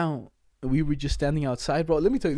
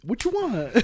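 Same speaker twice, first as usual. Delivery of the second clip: mock high voice; 9 semitones higher, slower than usual. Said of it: "I was like".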